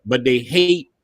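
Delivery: ordinary talk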